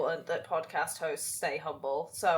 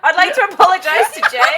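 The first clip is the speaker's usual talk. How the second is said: loud, high laughing apology; cracking up mid-apology; 10 semitones higher, 15 dB louder than usual. Real, not acted. Mock apology amid laughter.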